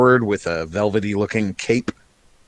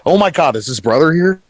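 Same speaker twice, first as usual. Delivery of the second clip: higher, louder, fast nervous question; nervous goblin; 7 semitones higher, 4 dB louder, faster than usual. Acted. Goblin noble fearing the brother, fast and higher. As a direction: rattle it off in alarm. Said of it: "looks around all nervous".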